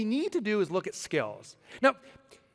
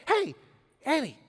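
high-pitched hail to a rock star; calling out; 9 semitones higher, 3 dB louder than usual. Acted. Would-be bandmates phoning a star guitarist, calling out. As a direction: call out to get his attention. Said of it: "Imagine us calling [name]".

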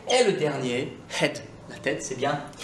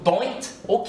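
Scottish DON'T and OK, doint and okee; Scottish vowels in don't, ok; Scottish accent; 7 semitones higher than usual. Shown as a demonstration. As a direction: say both words as a Scot. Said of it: "En écossais".

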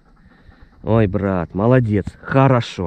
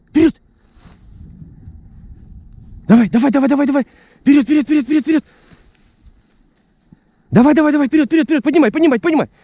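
high excited urging; 4 dB louder, faster than usual. Real, not acted. Urging the dog on the hunt.

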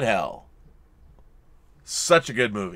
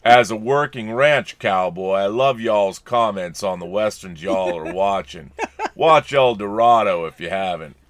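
John Wayne; 4 semitones lower than usual. Acted. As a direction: talk low like an old screen cowboy. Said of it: "That's from John Wayne".

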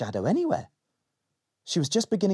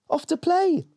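put-on cheerful little girl; 4 dB louder than usual. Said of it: "Molly answered".

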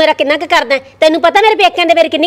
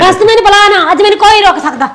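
angry; 5 semitones higher, 4 dB louder than usual. Acted. Wife furious, leaving for divorce.

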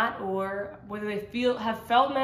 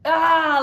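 loud, higher mock scream ah; imitated scream; 6 semitones higher, 9 dB louder than usual. Woman retelling deliverance, letting out a mock scream. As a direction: act out the release scream. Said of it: "the automatic".

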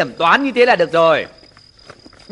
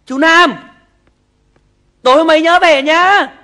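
high, loud call after him; 7 semitones higher, 5 dB louder than usual. Acted. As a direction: shout after him as he leaves. Calling after departing man. (gọi với theo to)

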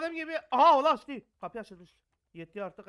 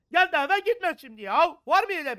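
exasperated yell; 7 semitones higher, 14 dB louder than usual. Real, not acted. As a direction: stretch the complaint out at full volume. Se plaint bruyamment.

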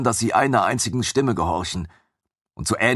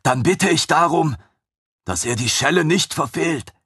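chained creature asking; 7 semitones higher than usual. Acted. Captive creature asking that Ragnar not miss, high and earnest.

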